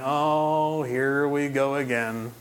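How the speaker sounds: low, drawn-out eye-rolling sigh of words; exasperated groan. Retold or imitated. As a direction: imitate jaded judges, slow and dismissive. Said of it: "rolling their eyes going".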